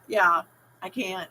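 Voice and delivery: ordinary delivery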